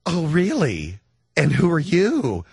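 drawn-out, doubting "oh, really?" tone; put-on sceptical restaurant host, slow on the question; skeptical host. Restaurant host to a self-declared celebrity, skeptical.

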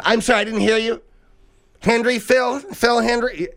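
high, clear counter clerk voice; pharmacist calling out. Retold or imitated. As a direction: call the name out like a pharmacist.

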